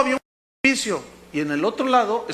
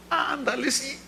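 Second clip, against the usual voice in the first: soft, higher mock coaxing; wheedling; 4 semitones higher, 7 dB quieter than usual. Balaam coaxing God to relent, soft wheedling. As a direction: beg softly, like a pestering child.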